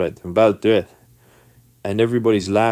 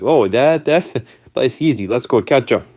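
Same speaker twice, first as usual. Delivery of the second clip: high, loud "oh, that's easy" voice; breezy, knowing brother; 4 semitones higher, 5 dB louder than usual. Retold or imitated. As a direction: toss the answer out brightly and loudly. Brother breezily supplies the word.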